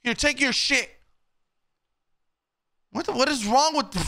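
high, agitated protest after a fright; rattled outcry. Real, not acted. Rattled player scolds the woman who scared him, high outcry. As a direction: blurt it out high, still shaken.

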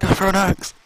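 loud, urgent call in the heat of play; urgent shout. Real, not acted. Player yelling a warning as an axe comes, urgent shout. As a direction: yell the warning at the enemy. Warning a game character mid-fight.

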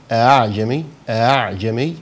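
أعجمي with its second hamza softened between hamza and fatha; eased hamza. Shown as a demonstration. Teacher showing students the correct Hafs reading of أعجمي. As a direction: say أعجمي with the hamza blurred toward the vowel. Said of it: "أنت كده مش سامع أنا قلت إيه".

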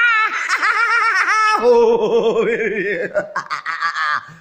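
long, wild cackling howl; unhinged drawn-out laugh; manic laugh. Acted. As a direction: laugh madly, trailing into a wobbling howl. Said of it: "péter un plomb".

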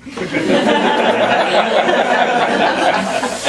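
long breathy laugh trailing down; airy laughter. Real, not acted. Laughing at his own prank.

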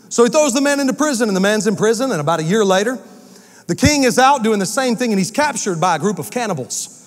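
ordinary talk

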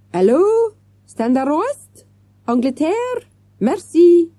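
high French waiter on phone; French accent. Acted.

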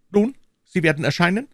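quick insistent king; the king pins her down; pressing king. Acted. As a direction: push for her yes.

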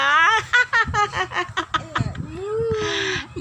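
long, high burst of laughter; laughing. Real, not acted. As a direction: crack up helplessly. Cracks up at the whispered cow.

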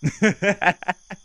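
loud, high burst of laughter; mocking laugh. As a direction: burst out laughing at their fear. Laughs at listener's frightened face.

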